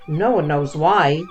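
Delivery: ordinary delivery, nothing marked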